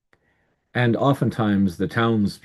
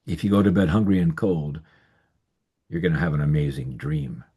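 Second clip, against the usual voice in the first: usual speaking voice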